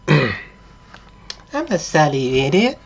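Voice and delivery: put-on high-pitched girlfriend doll; high mock-female voice; doll come to life. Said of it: "it turns around and it says".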